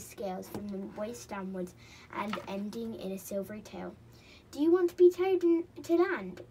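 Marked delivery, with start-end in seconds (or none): 4.5-6.4 loud, high creature voice; little merman. A narrator's reading. Merman offers a tow, high and loud. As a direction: offer help in a high storybook voice.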